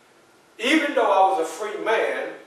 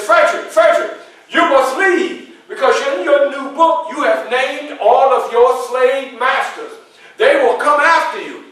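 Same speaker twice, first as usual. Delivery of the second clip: high, loud, alarmed pleading with a laugh breaking in; urgent warning; 8 semitones higher, 9 dB louder than usual. Retold or imitated. Garrison bursting in, urging Frederick to flee: high loud alarm. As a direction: sound the alarm as if they're at the door.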